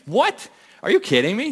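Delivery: can't believe what the mom just told him; shocked disbelief. Real, not acted. Speaker to the audience about the mom's seven-year-old, outraged disbelief.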